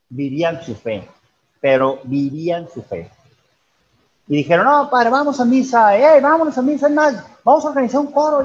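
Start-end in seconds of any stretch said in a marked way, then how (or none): 4.7-8.4 higher, louder, keen group suggestion; eager retold plea. Youths urging the priest and each other to go to mass, eager. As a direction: imitate excited youths rallying everyone.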